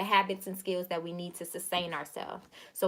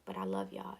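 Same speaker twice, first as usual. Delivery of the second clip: a soft, emotional whisper of love; tearful whisper; 12 semitones lower, 8 dB quieter than usual. Real, not acted. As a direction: whisper it, choked up and moved. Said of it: "y'all making me cry".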